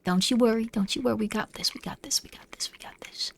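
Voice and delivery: whispered self-reassurance, fading out; nervous whispering. Real, not acted. Self-soothing in a scary game.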